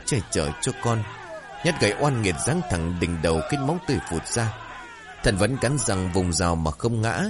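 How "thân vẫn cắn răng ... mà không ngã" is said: ordinary delivery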